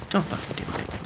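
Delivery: whispered, urgent warning; hushed curse. Real, not acted. To viewers weighing nose surgery, a whispered plea.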